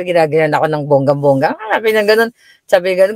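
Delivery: ordinary talk, nothing marked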